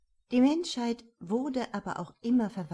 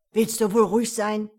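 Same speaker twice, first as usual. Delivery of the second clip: angry bark; 10 dB louder than usual. Acted. Cop barks at pinned suspect.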